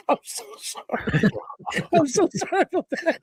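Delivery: high, squeaky apology through laughter; helpless laughter. Real, not acted. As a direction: apologize while cracking up. Cracking up while apologizing.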